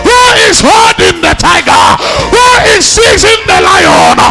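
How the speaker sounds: high, loud preaching roar as Paul's inner cry; shouted preaching cry. Acted. Preacher voicing Paul's restless spirit to the church, shouting. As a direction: bellow it out, full force, burning with frustration. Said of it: "Somebody said".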